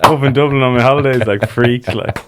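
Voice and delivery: laughing loud and high. Real, not acted. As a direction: laugh through the complaint. Joking complaint while laughing.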